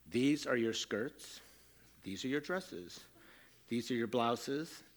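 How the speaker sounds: slow, soft, deliberately patient explanation; very calm. Retold or imitated. Father to tween daughter at her wardrobe, very calm and measured. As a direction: lay it out to her slowly and quietly. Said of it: "very calmly".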